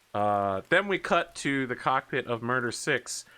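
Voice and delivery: ordinary talk, nothing marked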